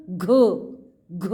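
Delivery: घ said slowly and clearly, one syllable; a teacher modelling घ for children; drilled syllable. Shown as a demonstration. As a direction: sound out घ, pausing after each. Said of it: "यानी घ बोलो सब".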